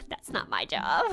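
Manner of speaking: dismissive inner voice. Retold or imitated. Acted inner thought, laughing.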